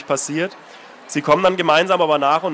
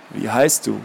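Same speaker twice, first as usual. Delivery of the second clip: put-on lower, rustling old man repeating; rustling old man's voice; 7 semitones lower, slower than usual. Old man asking Anna again, rustling voice. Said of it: "fragte der alte Mann erneut mit seiner raschelnden Stimme".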